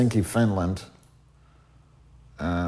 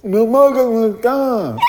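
"Milwaukee, Wisconsin" in a high, loud, blurred mock deaf voice; imitating deaf speech; 8 dB louder than usual. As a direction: say "Milwaukee, Wisconsin" as the deaf model did, the sounds smeared. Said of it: "but he was deaf".

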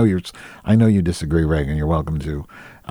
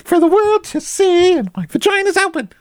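very high-pitched mock falsetto; flamboyant queenie voice. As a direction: squeal it out as an over-the-top camp caricature. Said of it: "they're really like Reagan Fox, all".

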